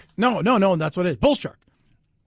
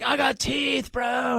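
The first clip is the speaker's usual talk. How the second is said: higher, softer cartoonish bro voice; mock bro shark; 4 semitones higher, 6 dB quieter, much slower than usual. Host voicing a toothy shark in the surfer's tale, mock bro. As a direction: play the shark as a surfer dude, lightly.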